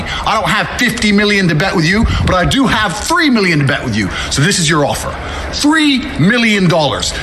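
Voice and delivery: loud, firm callout delivered straight; formal callout speech. Acted. Fighter calling out Jake Paul, loud firm challenge.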